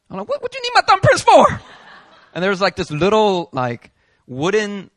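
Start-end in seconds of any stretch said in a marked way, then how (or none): 0.3-1.6 high, loud baffled squawk; shocked disbelief. Retold or imitated. Foreigner at the police station demanding why, shocked and high.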